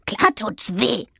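slow, irritated confirmation; mild growl. A narrator's reading. Witch answering the cat's question about pain, mildly growling. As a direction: draw it out grumpily. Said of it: "knurrte sie".

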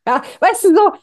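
chuckling with delight at how easy it was; amused, laughing it out. Real, not acted.

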